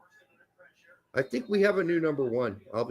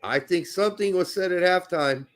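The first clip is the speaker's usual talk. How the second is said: normal talking voice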